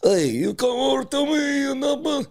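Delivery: very high, slow Russian-style accent; exaggerated Eastern European call, stretched out; mock Russian accent. Shown as a demonstration. Demonstrating a generic Russian or Armenian voice, high slow accent. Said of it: "I just do very".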